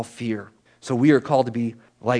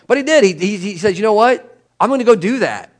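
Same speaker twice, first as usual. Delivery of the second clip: high and loud, thrilled; bursting with excitement at the news; excited; 7 semitones higher, 5 dB louder than usual. Real, not acted. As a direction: tell it with real excitement. Excited retelling of friend's decision.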